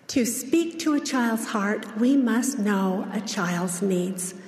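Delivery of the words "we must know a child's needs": ordinary talk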